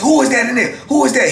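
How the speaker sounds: high-pitched imitation of a sergeant; retold challenge, pitched well up; demanding sergeant. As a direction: call it out like a sergeant questioning a prisoner. Sergeant challenges the man in cell.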